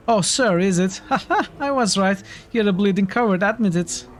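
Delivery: gleeful brawler mocking a rival; jeering thug. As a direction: laugh in his face and call him coward. Thug laughs at hero.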